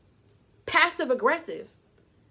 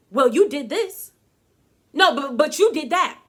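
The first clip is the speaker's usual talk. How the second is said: blaming retort; 3 semitones higher, 7 dB louder than usual. Retold or imitated. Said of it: "we can hide behind".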